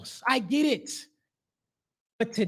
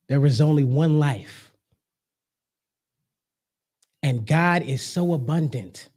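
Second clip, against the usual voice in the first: spiritual address; 8 semitones lower than usual. Acted. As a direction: speak it like a calm, grounded preacher. Turns to spiritual message.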